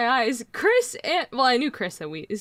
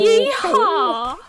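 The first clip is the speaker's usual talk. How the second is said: louder, higher cowboy holler; cowboy yeehaw; 6 semitones higher, 5 dB louder than usual. Retold or imitated. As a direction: whoop it like a rodeo cowboy. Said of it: "cowboy call after Texan impression".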